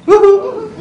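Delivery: high, loud woo-hoo of delight; gleeful cheer. Retold or imitated. Passenger rejoicing over an empty middle seat, gleeful cheer. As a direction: whoop it up, pitched way high.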